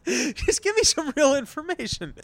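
fed up, pleading for real news through laughter; exasperated outburst. Real, not acted. Exasperated rant about the media.

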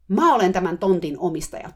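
loud, proprietorial shout. A narrator's reading. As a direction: shout that you own the place. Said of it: "huusi kovalla äänellä". (huutaen kovalla äänellä)

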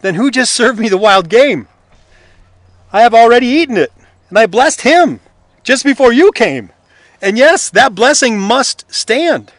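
loud, high, shocked father; agitated father. A narrator's reading. Isaac discovering he blessed the wrong son, loud agitation. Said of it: "Isaac begins to tremble uncontrollably".

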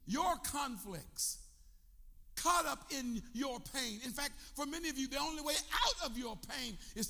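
high and loud, shouting the call to follow; full-force preaching. Acted. Preacher to congregation, shouting that they must follow. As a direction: drive it home to the crowd.

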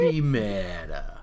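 slow catchphrase delivery. Shown as a demonstration. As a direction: drawl it out slowly. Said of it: "one of them is you saying".

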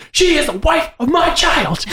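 hammed-up high comedy voice; mock movie character. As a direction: squeak it as a pompous declaration. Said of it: "Seth Rogen says".

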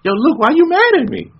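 high mocking voice; squeaky mock whine. Luke Cage mocks the villain in a high voice.

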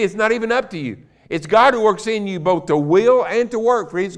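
high, loud preacher climbing to a peak; preaching at full force. Acted. Preacher to his congregation on God's strength, loud fervent preaching.

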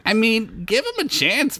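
high, unsure little voice; a small hero hedging nervously; timid Bilbert. Acted. Bilbert offers fry to orc.

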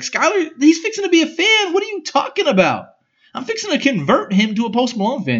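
high excited. Retold or imitated. Retold to sister-in-law.

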